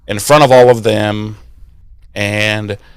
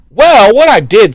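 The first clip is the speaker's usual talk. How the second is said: high-pitched mock explanation; high, swooping acted reply; girl explaining; 10 semitones higher, 6 dB louder than usual. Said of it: "And she's like".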